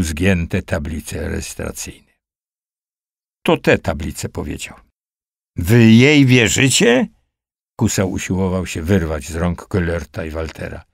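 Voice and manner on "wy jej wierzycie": higher, slow, incredulous wail; howling. A narrator's reading. Accused man protesting to his captors, drawn-out howl. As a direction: stretch the question into a desperate howl. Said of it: "Wył Kusał".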